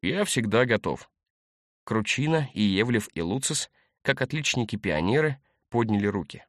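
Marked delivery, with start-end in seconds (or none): 0.0-1.1 slow, steady brisk promise; brisk, ready. A narrator's reading. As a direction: answer keenly, like a volunteer. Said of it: "бодро сообщил Тимофей Степанович". (бодро и с готовностью)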